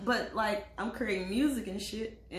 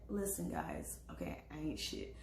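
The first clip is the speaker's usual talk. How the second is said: much lower, softer self-mocking admission; low confession; 8 semitones lower, 9 dB quieter than usual. Real, not acted. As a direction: drop low to confess your flaw. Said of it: "self-deprecating aside".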